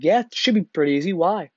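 breezily sure of yourself, imitating a green recruit; naive confidence. Naive rookie answering sergeant's challenge.

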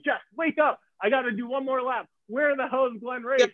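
high-pitched, exasperated urgency; urgent wake-up call. Retold or imitated. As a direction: rouse a sleeper, pitched high and insistent.

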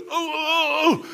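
high hammed-up ooh of pain; mock pained cry. Preacher reliving the pain of his fall, mock cries. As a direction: wail it like a hurt man. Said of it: "I got so mad".

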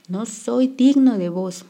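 bow down in jest; mock reverence. A narrator's reading. H mock bowing.

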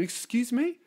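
high, rising affronted squeak; mock offended. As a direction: cut in with mock indignation. Offended fire interrupts again.

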